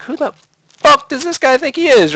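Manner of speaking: very high, squeaky mock outrage; indignant young self. Thirteen-year-old gamer reacting to his smug rival, squeaky indignation. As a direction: imitate an indignant kid way up high.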